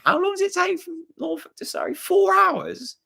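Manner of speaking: mock high, disbelieving voice of southern friends; a hammed-up, high-pitched incredulous pal. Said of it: "And they're like".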